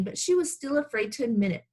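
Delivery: usual speaking voice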